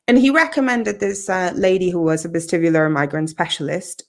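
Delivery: usual speaking voice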